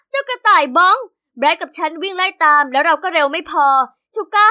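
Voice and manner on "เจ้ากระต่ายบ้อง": loud high yell after the bunny; loud call down the street. Acted. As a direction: shout at the rabbit to stop. Yelling after runaway rabbit.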